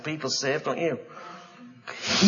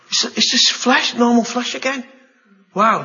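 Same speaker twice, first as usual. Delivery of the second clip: amazed relief; 10 semitones higher, 7 dB louder than usual. Acted. Moses amazed at healed hand.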